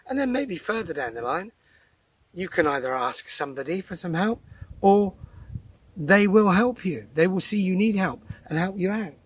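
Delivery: usual speaking voice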